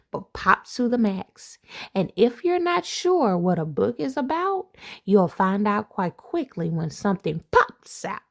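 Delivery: lively picture-book read-aloud; reading to children. Acted. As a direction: read it like a bedtime rhyme to kids. Reads rhyming picture book aloud.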